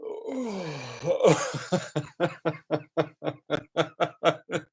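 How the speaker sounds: high wail dissolving into giggles; long giggling fit. Real, not acted. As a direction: lose it laughing mid-story. Cracks up telling yawning story.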